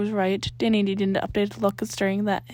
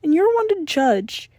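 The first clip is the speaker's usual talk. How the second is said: offended; 8 semitones higher, 5 dB louder, slower than usual. A narrator's reading.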